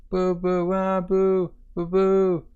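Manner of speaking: sustained high pretend wail; boo-hoo like a little child, long and high; mock toddler crying. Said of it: "he's crying".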